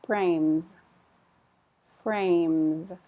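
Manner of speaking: Frames with a light soft z at the end; soft final Z. Shown as a demonstration. Teacher modelling frames for students, soft final z. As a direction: let the z in frames just barely buzz. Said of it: "it's very slight. It's very soft".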